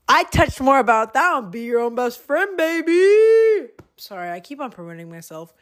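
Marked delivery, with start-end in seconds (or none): 1.5-3.7 high, loud, drawn-out cheer; playful hype. Pretend. Podcaster plugging their own episode to listeners, playful hype. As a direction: stretch it out high like a jingle tag.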